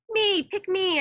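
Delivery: high, loud eager plea; loud, high excited begging; eager shout. A narrator's reading. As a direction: shout to be picked. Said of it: "I shouted".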